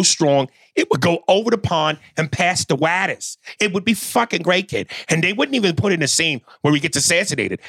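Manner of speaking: put-on Boston accent. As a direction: rant like a Boston guy who worships Brady. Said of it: "as you're doing the Boston accent".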